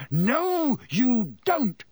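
high and loud, lunging for the wand; roaring. A narrator's reading. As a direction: roar it at the cat. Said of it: "roared Harry".

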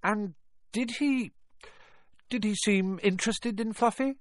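high, tentative question, slowing at first; trying to sound calm. A narrator's reading. Harry probes Hagrid about Fluffy, forced calm. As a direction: hold the eagerness back as you ask. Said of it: "trying to keep his voice calm".

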